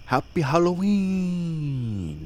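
high, long-stretched holiday wish; playful high croon; drawn-out greeting. Pretend. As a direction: hold the last syllable high, then let it slide down.

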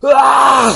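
long, loud high battle scream. A narrator's reading. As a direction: scream out as the punch comes. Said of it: "Superman screams".

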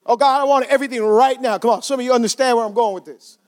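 normal talking voice

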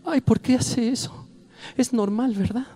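vocecita aguda de pasajera inquieta; señora nerviosa imitada. Retold or imitated. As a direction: imita a una señora que busca que la tranquilicen, sin gritar. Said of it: "una señora le está platicando a la de al lado". (vocecita de señora preocupada)

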